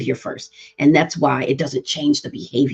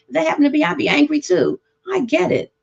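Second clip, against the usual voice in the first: empathic parent; 9 semitones higher than usual. Retold or imitated. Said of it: "now I'm gonna normalize that behavior".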